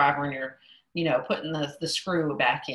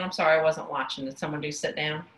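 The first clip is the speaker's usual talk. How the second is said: normal talking voice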